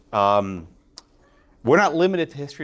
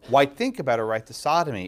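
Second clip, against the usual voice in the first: ordinary talk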